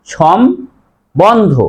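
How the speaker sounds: সম্বন্ধ split slowly, shom... bondho; correct pronunciation. Shown as a demonstration. Teacher gives correct form, syllable by syllable. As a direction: pause after shom, then a clear b in সম্বন্ধ. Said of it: "সঠিক উচ্চারণ হবে".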